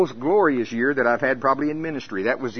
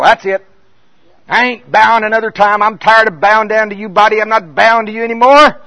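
loud, higher declaration of war; fired-up defiance; 6 semitones higher, 10 dB louder than usual. Retold or imitated. Preacher declaring war on his own body, loud and higher. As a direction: tell the body off, fed up.

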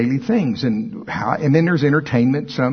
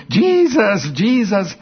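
ecstatic cry; 8 semitones higher, slower than usual. Retold or imitated. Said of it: "this guy running around the building".

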